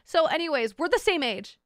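ordinary talk

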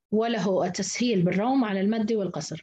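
ordinary talk, nothing marked

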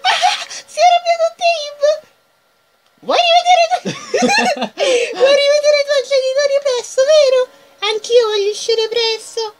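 high character voice. Acted. Voicing character, laughing through it.

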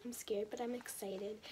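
usual speaking voice

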